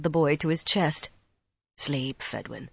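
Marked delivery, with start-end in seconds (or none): 1.8-2.7 low, very soft murmur. A narrator's reading. Rand holds the dying boy to his chest, murmuring. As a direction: murmur it as if settling a child to sleep. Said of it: "Rand murmured".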